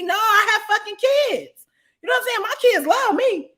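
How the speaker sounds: loud, high, slowed indignant retort; heated retort to a jealous boyfriend, loud and high; indignant outburst. Retold or imitated. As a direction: push back hard, incredulous.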